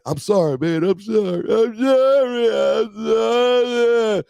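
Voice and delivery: high, loud, stretched-out wailing sorry; imitating a crying man begging sorry; mock crying apology. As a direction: whine it out like a sobbing man. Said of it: "He's crying".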